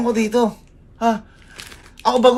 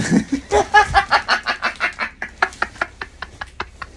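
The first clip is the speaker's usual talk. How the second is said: airy, breathless laughter; unvoiced fit of laughter; 10 semitones higher, 4 dB quieter than usual. Real, not acted. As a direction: laugh under your breath. Laughs after reading wrapper.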